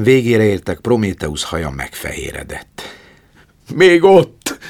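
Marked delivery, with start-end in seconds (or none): 3.7-4.6 high, loud cry; eager shout. A narrator's reading. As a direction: cry out hopefully. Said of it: "kiáltott aztán". (lelkes kiáltás)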